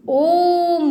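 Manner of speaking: ओम drawn out as a pluta vowel; prolonged vowel. Shown as a demonstration. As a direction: chant ओम with the vowel stretched. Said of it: "ज़्यादा समय लगता है हमारा प्लुत स्वर".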